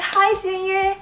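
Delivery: high, bubbly fangirl greeting; starstruck squeal. Retold or imitated. Fan greeting her idol Junior, squealing.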